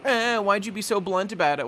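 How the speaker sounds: a touch of a whining crew member scolding; mock whiny complaint. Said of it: "everyone here ... is all getting on his case".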